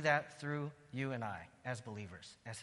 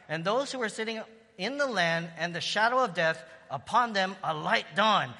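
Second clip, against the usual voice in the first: louder, higher proclaiming reading; fervent scripture reading; 4 semitones higher, 6 dB louder than usual. Real, not acted. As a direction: read it like a prophecy rising up. Reads scripture with rising fervour.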